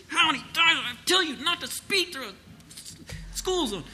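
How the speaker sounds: loud, high, hammed-up scolding; over-the-top furious cop; yelling police officer. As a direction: bawl out a speeding driver. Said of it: "he's not yelling".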